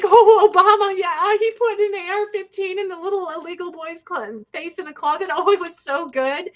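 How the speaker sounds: sneering impression of gushing fans; mocking voters. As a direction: parody the fans gushing about Obama. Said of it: "everyone's like".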